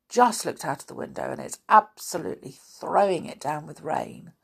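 ordinary delivery

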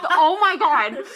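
loud, laughing exasperation; cracking up in disbelief; exasperated laugh. Real, not acted.

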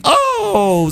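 high sharp oh; high cry. Acted. Gingerbread man being eaten.

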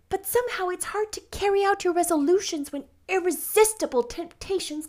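put-on high, gushing girlish chatter; rapturous. A narrator's reading. Anne chatters about the dress and being good, enraptured. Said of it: "said Anne rapturously".

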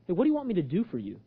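brisk, offhand question; casual quick repeat. Retold or imitated. Preacher requoting Jesus as a puzzle, casually quick.

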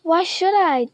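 defiant. A narrator's reading. John refusing Spider.

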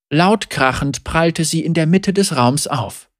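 plain reading voice

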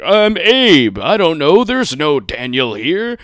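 loud, high hammed-up insistence, slowing at the end; loud mock denial. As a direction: insist on it like a lunatic. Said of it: "he's like".

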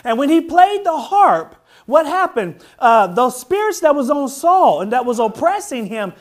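fervent high, loud preaching. Acted. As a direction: preach it with rising fire. Preaching at full force.

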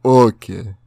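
put-on loud one-word reply in an Indian accent; thick Indian accent. Imitating the guy as stuff hits the counter, thick Indian accent. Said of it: "had the thickest Indian accent".

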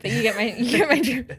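usual speaking voice

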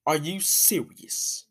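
soft, slow sighing disbelief; exasperated sigh. Retold or imitated. Ice cream came with nuts, soft slow sighing disbelief. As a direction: groan it out, defeated.

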